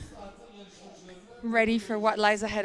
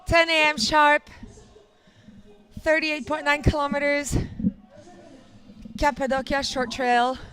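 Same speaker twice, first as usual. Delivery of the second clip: announcer read; 4 semitones higher, 6 dB louder than usual. Real, not acted. Announcing race details.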